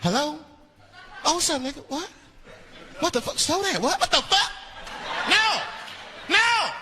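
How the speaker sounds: a driver hearing bad news on the phone; alarmed phone call.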